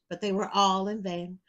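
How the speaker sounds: ordinary delivery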